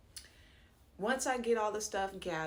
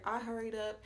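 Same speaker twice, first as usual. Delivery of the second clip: ordinary delivery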